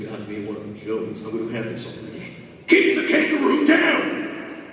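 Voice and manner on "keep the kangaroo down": high, loud, slow shout. Acted. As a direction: bellow the order. Shouting order in battle.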